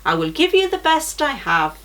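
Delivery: a hint of the generous host; innkeeper. A narrator's reading.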